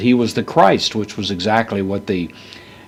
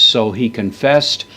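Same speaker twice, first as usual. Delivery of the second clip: ordinary delivery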